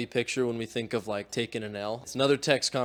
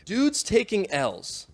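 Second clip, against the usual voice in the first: higher and slow, punching Dudes; dramatic topic reveal; 7 semitones higher, much slower than usual. Acted. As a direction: announce it like a title card. Announcing the video topic.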